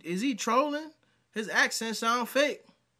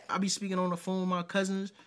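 ordinary talk

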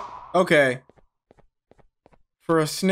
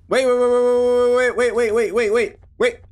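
panicked; 7 semitones higher than usual. Real, not acted. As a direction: plead to stop, rising panic. Panicked in game.

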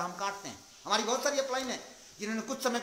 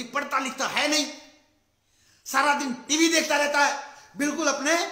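loud, higher mother's telling-off; angry scolding; 6 semitones higher, 8 dB louder than usual. Retold or imitated. Mother scolding her son for watching TV, loud and angry. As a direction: snap at the kid in fury. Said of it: "बोली".

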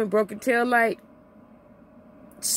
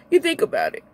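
ordinary delivery

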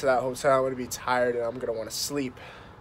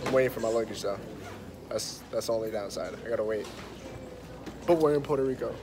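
normal talking voice

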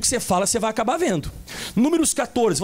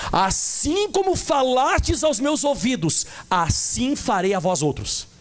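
slow solemn verse; slower than usual. Acted. Verse repeated slowly.

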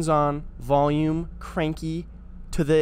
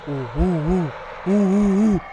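mock ape hooting, getting louder; monkey hoots; 3 semitones higher, 4 dB louder than usual. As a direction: hoot like a monkey, building up.

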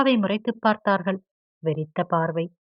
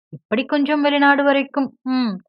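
a higher, louder mocking echo of his words; mocking mimicry; 7 semitones higher, 6 dB louder than usual. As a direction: parrot him back with a sneer. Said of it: "அப்படியே சொல்லிக் காட்டி". (கேலியாக அப்படியே திருப்பிச் சொல்லுதல்)

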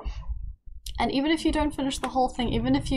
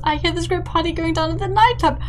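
voicing Kayla; 6 semitones higher, 5 dB louder, faster than usual. Voicing Kayla inviting to party.

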